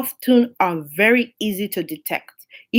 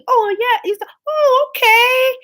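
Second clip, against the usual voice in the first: much higher, airy oh yeah okay, swallowing it whole; mock gullible acceptance; 13 semitones higher, 3 dB louder than usual. Preacher mocking listeners who don't question, much higher naive voice. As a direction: lampoon someone who believes anything.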